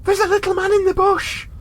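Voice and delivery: put-on high, loud panicked child; hysterical girl. Narrator voices the frightened daughter telling her mum in a high loud panic. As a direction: cry it out like a terrified girl. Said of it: "the girl hysterically told Leanne".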